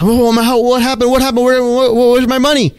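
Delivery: half-acted alarm at the empty account; panicked. Trader finding his account empty, high panicked questions. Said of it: "And I was going nuts".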